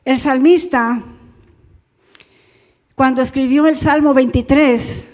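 ordinary talk, nothing marked

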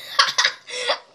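high burst of laughter. Real, not acted. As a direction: laugh at the reaction. Laughing at friend's reaction.

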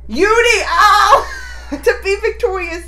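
high, loud laughing burst. Real, not acted. Viewer laughing at the grandfather's twist. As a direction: laugh the name out in disbelief.

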